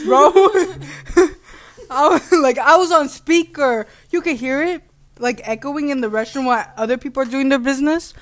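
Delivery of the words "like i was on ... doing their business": ordinary talk, nothing marked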